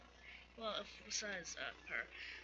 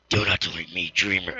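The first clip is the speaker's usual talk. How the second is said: much lower and louder, looming otherworldly tone; menacing creature voice; 13 semitones lower, 13 dB louder than usual. Acted. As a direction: boom it down like a monster speaking from the dark. Voicing a game character's warning.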